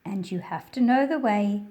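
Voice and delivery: projected read-aloud narration; reading to children. A narrator's reading. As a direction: read the picture book out to a class of kids. Reads picture book aloud.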